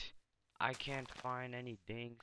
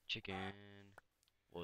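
ordinary talk, nothing marked

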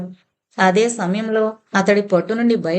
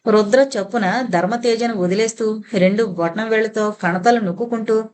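usual speaking voice

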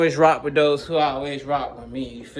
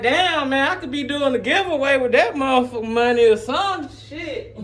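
laughing frustration; 7 semitones higher than usual. Retold or imitated. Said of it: "I'm like".

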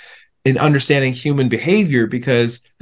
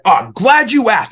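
higher, sarcastic angry imitation; retold angry reply; 8 semitones higher, 3 dB louder than usual. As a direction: imitate his angry, pointed retort. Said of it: "he was still really angry".

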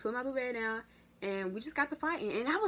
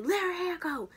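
coaches yelling; 9 semitones higher, 6 dB louder than usual. Retold or imitated. Coaches breaking up a fight.

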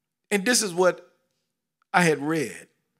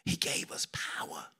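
whisper; 7 dB quieter than usual. Real, not acted.

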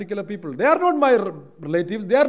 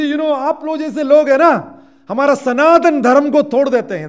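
retold angry outburst; 10 semitones higher, 14 dB louder, much faster than usual. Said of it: "And he said in Hindi".

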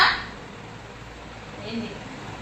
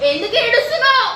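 higher and louder, pressing why; loud worried asking; 8 semitones higher, 6 dB louder than usual. Real, not acted. Mother asking her crying child why, loud worried call.

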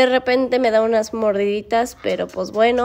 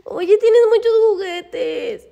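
high, drawn-out whine; whining protest; 8 semitones higher, 4 dB louder, slower than usual. Real, not acted. Woman pointing dog to his toys, whining protest. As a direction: stretch it out high, pleading.

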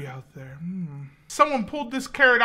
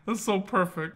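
normal talking voice

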